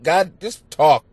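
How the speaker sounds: normal talking voice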